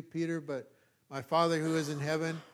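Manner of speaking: usual speaking voice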